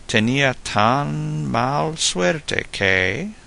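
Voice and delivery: drawn-out setup of a joke, "que" suspended; joke-opener drawl. Shown as a demonstration. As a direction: stretch "tan" and leave "que" hanging for the punchline. Acted opening of a Spanish joke.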